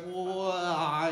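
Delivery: mildly acted, drawn-out amazement; stunned exclamation. Angler watching his pole vanish, mildly stunned. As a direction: stretch it out as if dumbstruck.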